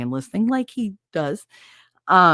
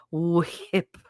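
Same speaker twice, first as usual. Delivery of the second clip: ordinary talk, nothing marked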